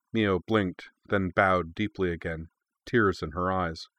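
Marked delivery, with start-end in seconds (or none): none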